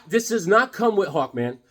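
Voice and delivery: normal talking voice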